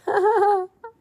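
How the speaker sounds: high-pitched rhythmic laughter. Acted. Man in hell answers the Lord, high laughter. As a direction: let out a high, pulsing laugh. Wordless outburst after the Lord's reply.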